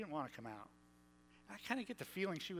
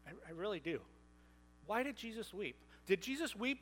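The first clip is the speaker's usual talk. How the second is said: ordinary talk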